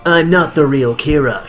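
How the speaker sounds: ordinary delivery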